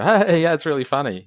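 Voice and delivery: high, loud, fake-cheery brush-off; forced laugh-along. Laughing off a pub stranger's Indian accent, fake cheery. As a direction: play along with the joke through your teeth. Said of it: "you kind of go".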